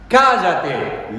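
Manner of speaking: loud high command to be silent; sharp rebuke. Acted. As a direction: cut him off with a shout. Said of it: "Lo reprendió". (reprensión cortante en voz alta)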